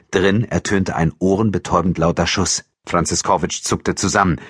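ordinary delivery, nothing marked

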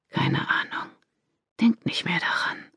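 whispered close into the ear; whispering a secret. Acted. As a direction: whisper softly into her ear. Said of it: "flüsterte er ihr ins Ohr".

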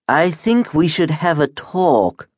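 lightly voiced polite wub, pitched high; high, mildly put-on creature voice; civil talking creature.

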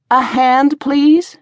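high, rising, impatient plea; insistent request. A narrator's reading. Tavern keeper to her dazed helper, insistently asking. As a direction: prod someone out of a daze.

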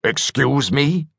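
slow, puzzled question; genuinely taken aback; surprised. A narrator's reading. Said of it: "The cyborg looked genuinely surprised".

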